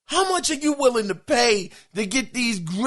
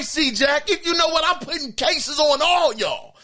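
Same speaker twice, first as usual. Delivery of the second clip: usual speaking voice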